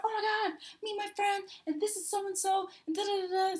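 high, soft gushing introductions; so excited. Retold or imitated. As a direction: gush in a small, high voice. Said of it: "I was so excited".

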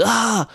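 sharp high "ah" of pain, imitated; retelling a mate's cry as he smacks his leg; pained yelp. Mate falls off the boat hitting the ladder, pained yelp. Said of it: "I heard this".

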